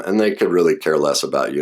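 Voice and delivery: usual speaking voice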